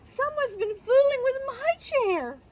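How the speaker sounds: high, aghast mother bear; Mama Bear. Acted. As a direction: gasp it out, pitched well up. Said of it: "Mama Bear dried hers and gasped".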